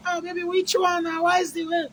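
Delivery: mock-tender boyfriend voice, high and soft; sweet-talking lover. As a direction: coax her in a high, soft, sugary voice. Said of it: "You can't say".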